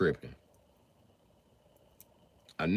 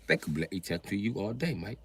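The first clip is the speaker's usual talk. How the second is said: put-on British accent; 8 dB quieter than usual. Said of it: "African motherfuckers in Britain".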